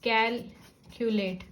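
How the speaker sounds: Calculate slowly, with a gap after कैल; syllable breaks. Shown as a demonstration. Teacher introducing another word, calculate said slowly with a break. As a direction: break calculate as cal... culate. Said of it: "इस तरह से एक और word".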